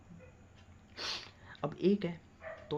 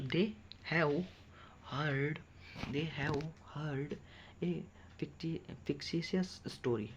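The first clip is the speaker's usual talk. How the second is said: slow dictation; much slower than usual. Shown as a demonstration. Teacher dictates slowly.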